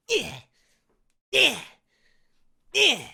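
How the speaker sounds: rhythmic effortful grunts, the last one big and loud; working grunts. Acted. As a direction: grunt like a farmer hard at work. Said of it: "like working sort of grunts".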